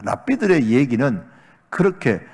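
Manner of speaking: usual speaking voice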